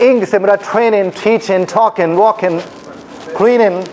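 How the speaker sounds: British accent, every -ing ending on n with no g. Shown as a demonstration. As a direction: drop each final g in the British way. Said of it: "तो ग का sound नहीं". (British लहजा, आख़िर का ग ग़ायब)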